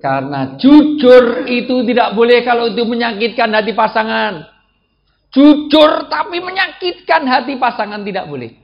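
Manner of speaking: high, loud preacher's proclamation; forceful preaching. Real, not acted. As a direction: hammer the rule home to the congregation.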